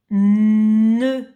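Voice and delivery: Na said loud and clear; dictation. Shown as a demonstration. Teacher dictating a syllable to the child, loud and clear. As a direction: pronounce Na for dictation. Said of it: "tu écris ne".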